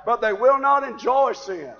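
high, loud sermon shout; full-force preaching. Acted. Preacher warning church against enjoying sin, full-force preaching. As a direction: thunder it out at the congregation.

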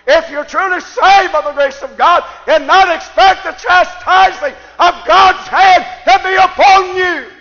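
shouted sermon, pitched high and very loud; full-force preaching. Acted. Preacher warning believers of God's chastening, full-force preaching. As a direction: thunder scripture at the congregation, stretching the big phrases.

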